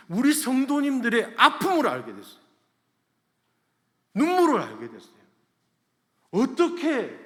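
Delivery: high, loud, forceful preaching; impassioned preaching. Real, not acted. Pastor telling of his congregation's hidden pain, impassioned preaching.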